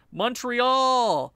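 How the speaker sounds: loud mock French-accented "Montreal"; bad French accent. Announcing the game against Montreal, in a mock French accent. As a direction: call it out in a badly faked French accent. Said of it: "no idea how to do a French accent".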